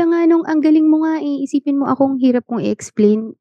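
high, bright thrilled praise; delighted. Real, not acted. Guest thrilled the host found the word, excited. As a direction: gush with real delight.

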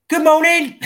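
bright, high, cheerful good morning; cheery greeting. Retold or imitated. Cheerful greeting to strangers on road.

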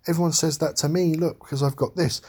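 lightly voiced little girl comforting the clown; mimicking a small girl reassuring someone. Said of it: "And she says".

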